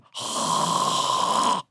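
long, noisy sucked-in breath; drawn-out mock gasp; imitated crying gasp. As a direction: mimic gulping on the nitrous. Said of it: "in my hand going".

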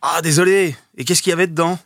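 put-on high, loud boyish voice; child voice. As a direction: play a young boy apologising. Re-enacting Gremlins child role.

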